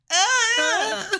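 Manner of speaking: very high, loud shrieked ah; mock scream. Imitating the kid asked his birthday, a high shriek. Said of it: "And he goes".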